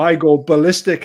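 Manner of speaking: normal talking voice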